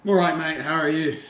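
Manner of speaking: put-on loud, lifted take on an Australian accent; retold Australian-accented greeting; imitated Australian accent. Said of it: "the thickest Australian accent I think I've ever heard".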